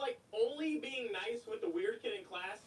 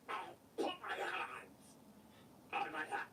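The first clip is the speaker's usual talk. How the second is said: whispered repeat; 7 semitones lower, 4 dB quieter than usual. Retold or imitated. Repeating the meek quote.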